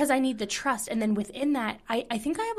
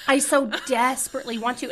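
louder, emphatic longing; eager echo; 4 semitones higher, 8 dB louder than usual. Real, not acted. Echoing her friend's eager longing, louder.

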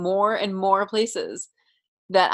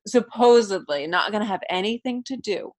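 ordinary talk

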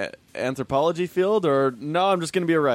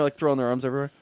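normal talking voice

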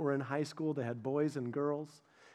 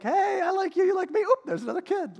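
high, loud, breezy silly voice; hammed-up clueless young parents; mock carefree couple; 6 dB louder than usual. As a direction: play it dumb and cheerful.

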